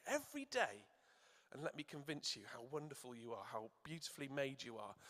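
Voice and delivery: very soft, fading tender words; gently voiced loving King; soft invitation. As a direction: let it sink to a hush. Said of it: "what our King says to us".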